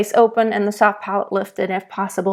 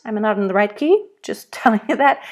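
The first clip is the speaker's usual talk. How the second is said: ordinary talk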